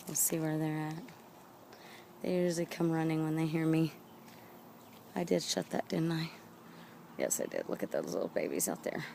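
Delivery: ordinary talk, nothing marked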